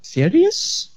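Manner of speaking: high rising mock "Serious?"; Egyptian accent. Friend mocks the word in an Egyptian accent. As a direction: tease with the boss's accent. Mocking the Egyptian boss's catchphrase.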